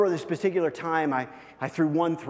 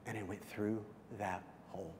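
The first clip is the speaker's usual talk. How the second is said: hushed tender memory; 3 semitones lower, 11 dB quieter than usual. Real, not acted. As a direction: tell it softly, moved by the memory.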